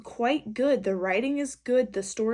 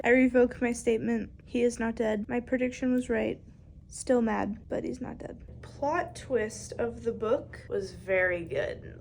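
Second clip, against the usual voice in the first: ordinary talk, nothing marked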